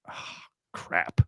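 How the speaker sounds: soft, breathy letdown; a hushed sigh of dismay; dismayed groan. Acted. Fans reacting to abrasive remarks, dismayed and hushed.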